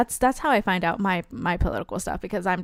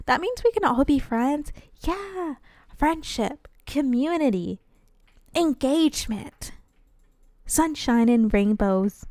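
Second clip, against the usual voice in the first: higher-pitched, mock-dreamy friendship pitch; lightly hammed-up cheeriness; 5 semitones higher, slower than usual. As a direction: list it off with a touch of sugary idealism. Acting out sugary game dialogue.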